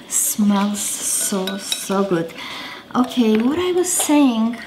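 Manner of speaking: usual speaking voice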